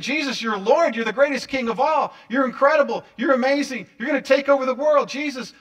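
gushing, high and loud, tumbling praise; excited. Acted. Peter hailing Jesus as coming king, excited. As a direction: burst out with big eager ambitions. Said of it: "here's Peter saying ... I'm so excited".

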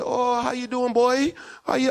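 high-pitched imitation of a Bajan greeting; Bajan accent. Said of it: "How is this accent".

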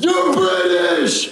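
high, drawn-out excited yell; amazed shout. Retold or imitated. Recalling meeting real Brits, high amazed shout. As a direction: shout it in hammed-up astonishment. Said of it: "I was like".

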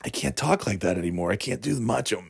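ordinary talk

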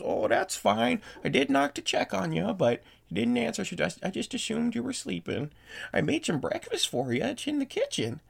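put-on high-pitched little old lady; sweet, high old-woman voice; sweet old host. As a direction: pitch it up high as a kindly hostess.

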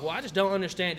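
high, loud protest in imitation; mock pissed off. Bar customer arguing over being cut off, mock pissed off. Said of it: "he gets all pissed off".

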